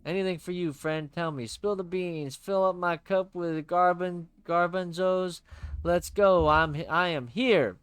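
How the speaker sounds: loud, bright pal voice; cheery buddy voice. Acted. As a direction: play the upbeat best friend.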